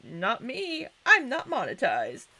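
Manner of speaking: mock-snarky comeback, swooping up high; sing-song snarky denial. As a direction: toss it back with playful snark. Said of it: "I can't be all snarky".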